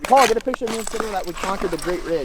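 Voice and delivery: normal talking voice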